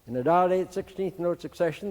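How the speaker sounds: ordinary talk, nothing marked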